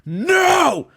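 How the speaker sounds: high, loud mock heckler cry; heckler shouting no. Lady in the front row heckling the comic, shrill loud shout. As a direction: yell it like an outraged woman in the front row. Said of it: "some lady in the front row looks at me and goes".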